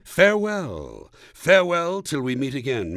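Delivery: theatrical mock-solemn parting; grand farewell. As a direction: proclaim it like an old herald. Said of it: "Now I say".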